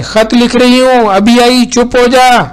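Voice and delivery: high, loud shout. A narrator's reading. Mother shouting back to her child while writing a letter. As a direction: yell it back across the house, high and loud. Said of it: "जोर से".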